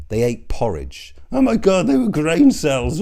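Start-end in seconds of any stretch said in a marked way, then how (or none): 1.3-3.0 high, loud mocking squeal, slowed down; mocking whiny voice. Mocking a health influencer in a high squeal. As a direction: sneer at him like a fussy health guru.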